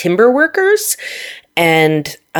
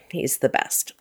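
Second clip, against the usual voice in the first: very soft, almost whispered; tender hush; 2 semitones lower, 12 dB quieter than usual. Real, not acted. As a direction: let it drop to a fond near-whisper. Fond praise of spouse.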